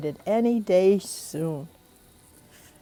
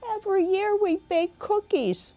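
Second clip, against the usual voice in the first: put-on sad little girl; 12 semitones higher than usual. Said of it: "Little Nina sadly explained".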